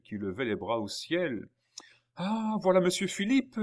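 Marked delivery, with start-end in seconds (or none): 2.2-3.6 high, loud cry of alarm; terrified cry. A narrator's reading. Maid seeing the son return alone, crying out in terror. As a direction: cry out in dread at the sight. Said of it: "s'écria Nicole avec un accent de terreur".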